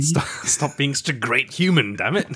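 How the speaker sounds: fond exasperation at a great actor; mock frustration. Real, not acted. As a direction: scold him for being too nice. Gushing over Sean Astin.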